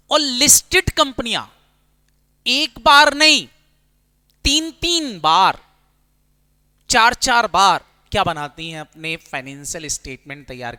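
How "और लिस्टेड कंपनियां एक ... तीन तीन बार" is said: higher, louder and slow, each phrase hit hard; emphatic teaching. Shown as a demonstration. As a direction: drive the point home to the class, word by word. Teacher hammers key point. (ज़ोर देकर, धीरे-धीरे और ऊँची आवाज़ में)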